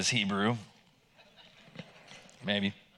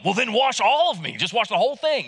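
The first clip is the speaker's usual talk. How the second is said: higher and louder, all-in enthusiasm; eager outburst; 8 semitones higher, 5 dB louder than usual. Retold or imitated. Peter begging Jesus to wash him entirely, eager and loud. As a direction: blurt it out like an overeager disciple.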